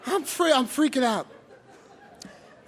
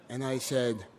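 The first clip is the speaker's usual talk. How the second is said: normal talking voice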